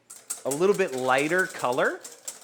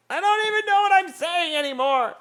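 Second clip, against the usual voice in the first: high, louder cartoon voice, playful; silly squeaky voice; 5 dB louder than usual. Shown as a demonstration.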